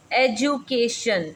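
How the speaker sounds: "एजुकेशन" drawn out slowly for learners; syllable-by-syllable demo. Shown as a demonstration. Teacher shows students an example word, slow syllable-by-syllable demo. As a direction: say "एजुकेशन" slowly, stretched out in parts. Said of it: "example देखें".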